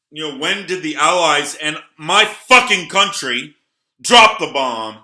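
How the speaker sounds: loud, angry rant, pitch up; real anger breaking into a shout; worked-up outburst. Real, not acted. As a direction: boil over at your own country.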